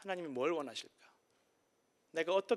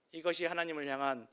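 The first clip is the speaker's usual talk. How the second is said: ordinary talk, nothing marked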